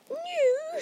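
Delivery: sudden high, loud shriek; scornful aunt shrieking the word back. A narrator's reading. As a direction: shriek it, pitch shooting up. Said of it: "shrieked Aunt Petunia suddenly".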